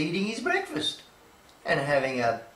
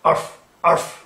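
dog barking; 7 dB louder than usual. Acted.

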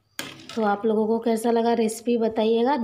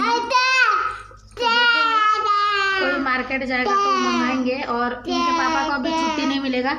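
raised voice; 8 semitones higher, 6 dB louder than usual. Real, not acted. Raised voice over baby.

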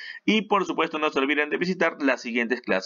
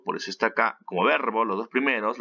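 usual speaking voice

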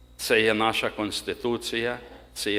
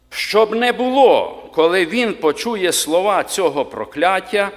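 solemn scripture reading; 2 semitones higher, 4 dB louder than usual. Acted. (урочисте читання Писання)

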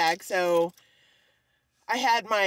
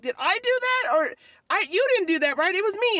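flustered disbelief; 8 semitones higher, faster than usual. Retold or imitated. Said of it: "And I'm like".

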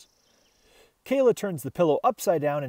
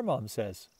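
usual speaking voice

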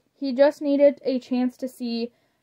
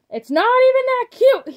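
high, loud indignant protest; exasperated protest; 13 semitones higher, 8 dB louder than usual. Acted. Father resisting the girls' sad faces, high loud exasperated protest. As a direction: insist it's not cute, cracking.

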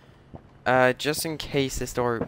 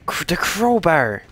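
excited; 7 semitones higher, 7 dB louder, slower than usual. Real, not acted. Game discovery, excited exclamation.

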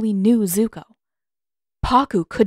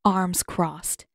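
usual speaking voice

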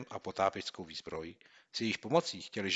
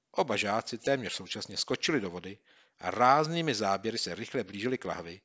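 usual speaking voice

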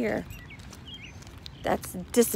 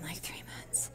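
whisper; 5 semitones lower, 13 dB quieter than usual. Real, not acted. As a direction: hush it to the camera. Quiet gym.

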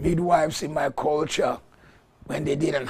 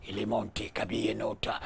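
unvoiced whispered prayer in tongues; 2 semitones lower, 6 dB quieter than usual. Real, not acted. Pastor whispering tongues over the congregation.

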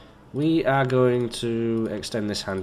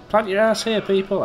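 loud, higher-pitched call to a crowd; 9 semitones higher, 5 dB louder than usual. Acted. Calling people over to sit on the bench, called out. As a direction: announce it brashly to everyone.

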